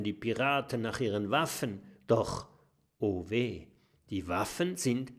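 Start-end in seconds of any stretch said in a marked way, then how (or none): none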